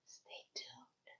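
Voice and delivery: barely audible whisper; whispered teaser. Real, not acted. Podcaster teasing listeners with what comes next, faint whisper.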